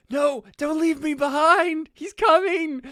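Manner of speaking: high, loud, drawn-out cry; panicked wail. Acted. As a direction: wail dramatically to friends who are running off. Paul abandoned in the road, pursued.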